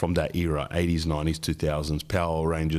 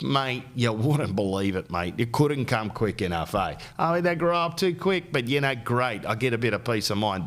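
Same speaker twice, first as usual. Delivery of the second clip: mock broad Aussie dad, higher; Aussie bloke; 5 semitones higher than usual. Aussie dads at school drop-off, mock broad Aussie accent. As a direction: imitate a relieved Aussie dad.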